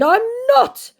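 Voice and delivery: very high, loud mock protest; exaggerated indignant scammer voice. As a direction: squeal the scammer's denial. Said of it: "said my scammer".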